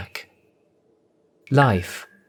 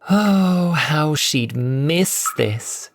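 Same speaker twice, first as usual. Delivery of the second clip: savouring; 6 semitones higher, much slower than usual. A narrator's reading. Cassandra savouring her freedom.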